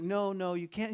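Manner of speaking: mildly urgent put-on interviewer; alarmed interviewer. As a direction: cut in as a worried reporter. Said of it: "he's like".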